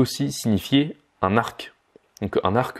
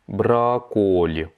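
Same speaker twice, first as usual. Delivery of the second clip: брокколи drawn out, every syllable clear; slow teaching repeat; much slower than usual. Shown as a demonstration. Teacher slowing брокколи down for students. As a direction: say брокколи slowly for learners. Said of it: "slow repeat of vocabulary".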